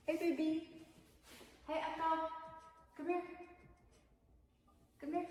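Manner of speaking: high sweet pet voice; talking to a dog. Real, not acted. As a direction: coo to the pet.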